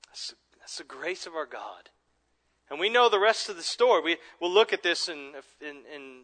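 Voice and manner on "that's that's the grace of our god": whispered, softer words of wonder; awed whisper. Real, not acted. Pastor to congregation after the quote, whispering in awe. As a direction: let it fall to a reverent whisper.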